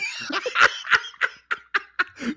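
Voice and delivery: very high laughing shriek. Real, not acted. Burst of laughter.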